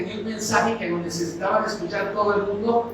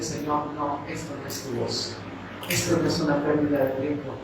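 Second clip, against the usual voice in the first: low, softened plea to God; retold quiet prayer; 5 semitones lower, 6 dB quieter than usual. Boy kneeling to answer the Lord, hushed retold prayer. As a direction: murmur it low, as if on your knees.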